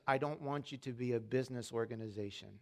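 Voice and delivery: a hint of a tender divine voice; God's tender voice. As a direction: speak it like a gentle promise. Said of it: "And he says".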